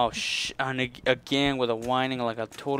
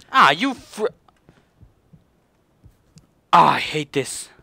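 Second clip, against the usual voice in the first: frustrated shout; 3 semitones higher, 10 dB louder than usual. Real, not acted. Failing a jump.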